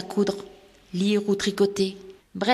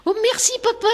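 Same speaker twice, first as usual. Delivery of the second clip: much higher, delighted; joyful thanks; 14 semitones higher, faster than usual. Acted. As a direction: thank papa with a joyful leap. Rosemary thanks father, hugging him. (fillette qui remercie papa, ravie et aiguë)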